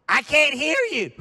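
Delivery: put-on loud, high old man's holler; hard-of-hearing shout. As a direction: bellow it as if half deaf. Hard-of-hearing man answers loudly.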